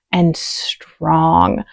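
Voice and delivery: ordinary delivery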